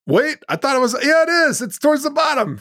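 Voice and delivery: catching him out on the list; surprised correction. Real, not acted. Correcting co-host about list.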